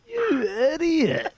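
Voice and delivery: high, slow mocking jeer; mock him like a laughing teammate; teasing high drawn-out taunt. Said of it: "he laughed at me and he goes".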